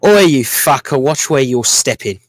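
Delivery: lightly done rough London accent. Shown as a demonstration. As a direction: have a go at a rough London halfling. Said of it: "Can I try to give it an accent?".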